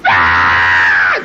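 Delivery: sudden low scream of a little sister, hammed up; imitated scream. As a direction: act out her terrified shriek. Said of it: "just screaming".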